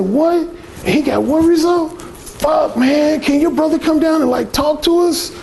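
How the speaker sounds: high, eager incredulity; intrigued guys. Retold or imitated. Guys asking to meet the brother, intrigued and amazed. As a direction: imitate amazed men pleading for the secret. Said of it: "they get really intrigued".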